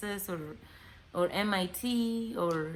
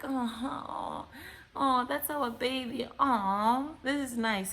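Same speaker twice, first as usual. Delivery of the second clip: mock cooing delight; 4 semitones higher, slower than usual. Woman cooing over kid pictures.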